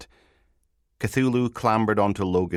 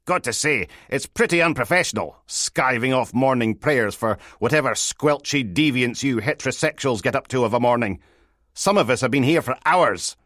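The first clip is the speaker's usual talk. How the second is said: higher, louder mock disapproval; sleazy detective scolding; 7 semitones higher, 5 dB louder than usual. Detective scolds sergeant for missing morning prayers, mock-indignant. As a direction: rattle off a teasing reprimand.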